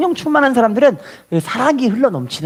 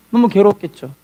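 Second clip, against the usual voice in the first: ordinary delivery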